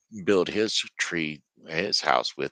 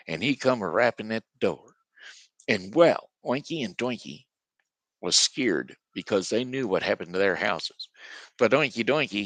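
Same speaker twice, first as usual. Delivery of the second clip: plain reading voice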